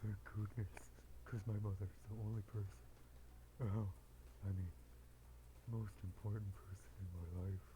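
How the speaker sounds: low put-on character voice; low, lightly earnest character voice; Huntress, relieved.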